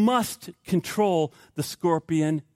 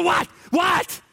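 high, sudden startled shout; hammed-up jolt of panic; startled yelp; 13 semitones higher than usual. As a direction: yelp back in mock alarm. Startled driver answering wife's scream.

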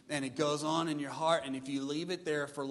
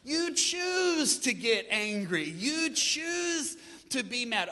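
slow, loud, higher, with choose hit hard; stress on choose; 8 semitones higher, 5 dB louder, slower than usual. Real, not acted. Preacher telling the church anger is chosen, slow and stressed. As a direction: drag it out and hammer choose.

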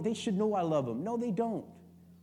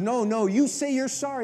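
insistent wife, a touch louder; 5 semitones higher, 6 dB louder than usual. Retold or imitated. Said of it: "She goes".

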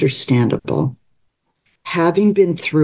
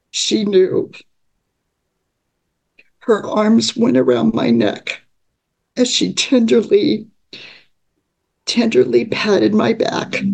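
higher, emotional reading with halting pauses; moved by the memory; 5 semitones higher than usual. Real, not acted. Reading her own story of being comforted by family, moved and tender. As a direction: voice lifts with welling feeling, pausing to hold on. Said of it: "going back to the emotions".

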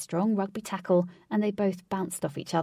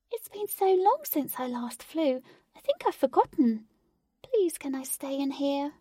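high, small begging voice; high timid fairy plea; pleading fairy; 8 semitones higher than usual. A narrator's reading. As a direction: beg to stay inside the box.

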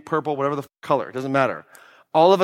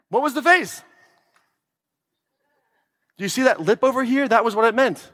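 imitating baffled guy; 6 semitones higher than usual. Acted couple quarrel.

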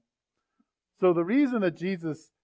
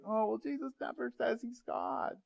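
higher, softer mock-objector voice; imitated objectors; 9 semitones higher, 8 dB quieter than usual. Preacher voicing what a lot of people say, mock objection. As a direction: mimic a doubter in a high, small voice. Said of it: "A lot of people say".

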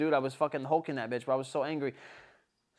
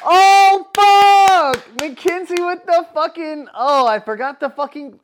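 high, loud yell of realization; real frustrated shout; sudden exasperated outburst; 12 semitones higher, 14 dB louder, slower than usual. Real, not acted. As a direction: yell as if it just hit you. Realizes he forgot the story's punchline.

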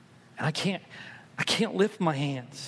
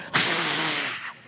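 crowd cheer at a home run. Retold or imitated.

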